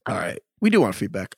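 ordinary talk, nothing marked

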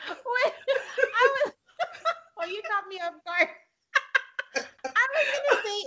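high-pitched, laughing through the words. Real, not acted. Flustered guest caught off guard by co-host, laughing. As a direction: try to talk through a surprised giggle fit.

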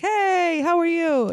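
high, sing-song neighborly hello; friendly greeting. Retold or imitated. New Yorkers greeting across rooftops, friendly high-pitched call. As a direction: call it out sweetly, drawn out. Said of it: "people are very nice".

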